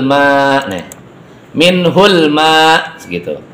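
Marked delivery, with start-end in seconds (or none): 1.5-3.4 Minhul ma with the ma drawn out long; lengthened madd. Shown as a demonstration. Teacher showing pupils how to read: ma stretched long. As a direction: hold the ma for five counts. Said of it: "Harus lebih dari dua ketuk".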